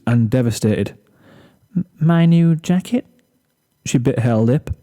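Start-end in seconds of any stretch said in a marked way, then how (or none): 1.8-3.1 high, stuttering near-sob; stammering on tears. Acted. Fluttershy near tears asking about her jacket, high stammer. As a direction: hold back tears, stumbling. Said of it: "She sniffled".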